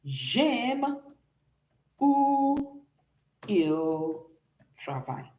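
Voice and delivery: drawn-out words with long pauses, pitch starting high and dropping; word-by-word dictation. Shown as a demonstration. Teacher writing out the French translation, slow word-by-word dictation. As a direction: dictate it one word at a time.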